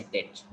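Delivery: low "debt" as det, silent B; correct debt. Shown as a demonstration. Teacher confirming the answer, debt with silent B. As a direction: say "debt" to rhyme with get. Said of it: "It is not debt at all".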